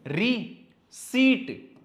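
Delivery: Receipt stretched very slowly; drawn out. Shown as a demonstration. Teacher drills receipt for students, very slowly. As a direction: pull receipt out long and slow. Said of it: "पी लगा के भूल जाना".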